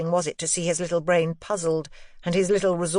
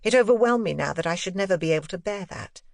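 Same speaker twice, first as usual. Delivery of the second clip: ordinary delivery, nothing marked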